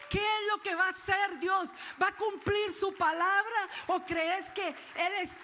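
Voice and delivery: loud, rising preacher's call, pressing each question home; full-force preaching. Acted. Preacher challenging the congregation to believe, in a loud shout.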